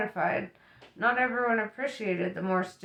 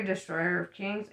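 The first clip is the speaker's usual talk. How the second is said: usual speaking voice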